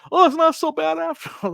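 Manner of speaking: high-pitched mock concession; imitated kid haggler. Seller retelling the boy's change of heart, imitated kid haggler. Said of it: "He was like".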